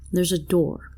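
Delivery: ordinary delivery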